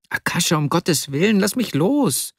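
high-pitched plea, climbing at the end; pleading Vasja. A narrator's reading. Weaker friend begging Arkadi for release, high pleading.

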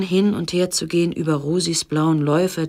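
plain reading voice

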